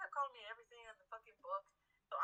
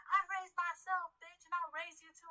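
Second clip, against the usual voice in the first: mock yelled comeback; 6 semitones higher, 4 dB louder than usual. Worker's snappy comeback.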